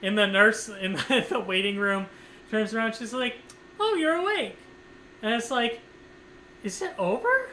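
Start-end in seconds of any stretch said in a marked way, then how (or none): 3.8-4.6 nurse voice. Retold or imitated. 6.6-7.5 dazed question. Retold or imitated. Waking from anesthesia.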